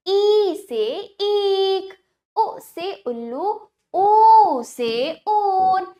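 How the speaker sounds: slow, measured letter-and-word chant; alphabet recitation. Shown as a demonstration. Teacher asking children to say along, slow recitation. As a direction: stretch the long vowels for children to repeat. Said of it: "मेरे साथ बोलिए बच्चों".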